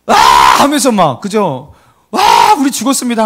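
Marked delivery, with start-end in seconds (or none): 0.1-0.1 sudden loud "aah" scream; panicked scream. Shown as a demonstration. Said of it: "당황한 비명의 소리를". 2.1-3.2 high-pitched mock panic cry; panicked shout. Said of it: "소리를 그렇게 지르면서".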